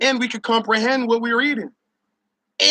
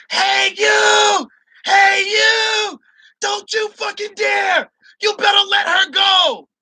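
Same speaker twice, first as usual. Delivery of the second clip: mock screaming lady; 9 semitones higher, slower than usual. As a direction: scream it like an angry old-road lady.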